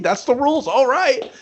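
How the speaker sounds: high, eager mock agreement; mock mom. The mom accepting the naked fight, high eager mockery. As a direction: chirp it like a game mom.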